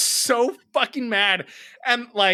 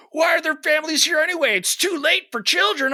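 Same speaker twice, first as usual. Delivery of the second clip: high, quickening irate complaint; retold indignant protest; 10 semitones higher than usual. Man at cosmic bowling objecting to families, indignant. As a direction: imitate an affronted man arguing back. Retold angry comeback from the guy.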